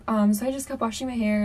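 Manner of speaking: plain reading voice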